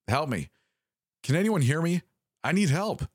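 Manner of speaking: high, straining call for help; a caller crying out for rescue; desperate plea. A narrator's reading. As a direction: beg to be heard over the radio. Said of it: "The voice sounded desperate".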